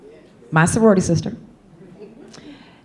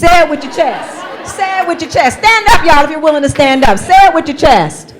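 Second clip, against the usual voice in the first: louder and higher rallying cry; calling out; 12 semitones higher, 9 dB louder than usual. Real, not acted. Coach urging the audience to stand up, rallying shout. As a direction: fire up the room, say it with your chest.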